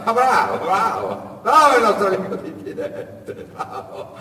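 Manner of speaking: loud high laughing bravo, fading out; laughing mockery. Ciccione laughing at distrustful Asad, loud mocking laughter. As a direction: laugh him off. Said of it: "sbottò in una grassa e sonora risata".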